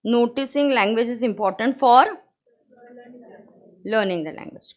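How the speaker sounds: very slow dictation, phrase by phrase. Acted. Teacher dictating the notes line for students to copy. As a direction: dictate it slowly so they can write.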